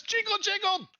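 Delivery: loud, very high character shout; high-pitched hammed-up order; impatient summons. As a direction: yell upstairs in a squeaky put-on voice. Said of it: "He says".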